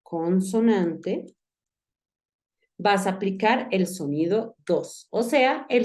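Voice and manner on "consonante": consonante drawn out slowly, syllable by syllable; slow, careful repeat. Shown as a demonstration. Teacher repeating the word slowly for the student. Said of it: "en una consonante".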